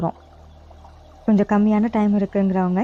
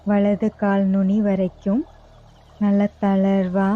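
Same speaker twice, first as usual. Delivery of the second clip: slow, even yoga nidra guidance with long pauses; guided meditation; 4 dB quieter than usual. Real, not acted. Yoga teacher guiding listeners lying down to relax each body part, guided meditation. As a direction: lead them unhurriedly into rest, phrase by phrase.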